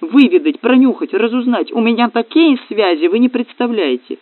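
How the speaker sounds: high, eager stream of offers; frantic gnome selling himself; crying out. A narrator's reading. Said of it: "закричал гном".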